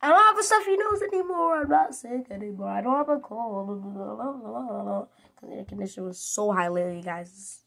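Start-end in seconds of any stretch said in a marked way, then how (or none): 0.0-3.8 higher, louder, fast happy rush; thrilled update to viewers; excited announcement. Real, not acted. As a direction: burst out with the good news.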